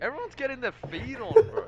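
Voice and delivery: squeaky high teasing echo; high mock hype. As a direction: pitch way up to mock the line. Repeating friend's line, mock hype.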